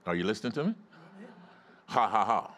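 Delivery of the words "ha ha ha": deliberate fake-sounding laugh; forced, evenly spaced mock laugh; faith laugh. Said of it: "It sounds like a fake laugh".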